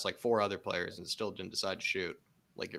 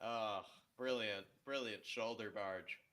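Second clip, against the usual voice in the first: soft, slow sarcastic praise; 11 dB quieter, slower than usual. Real, not acted. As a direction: say it dripping with sarcasm.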